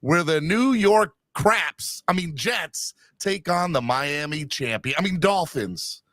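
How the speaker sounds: slow, drawn-out stadium announcer; voice-of-God broadcast voice. Pretend. Host welcoming fans to MetLife Stadium, slow broadcast voice. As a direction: turn on the booming game-day welcome, slowly. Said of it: "the voice of God broadcast voice".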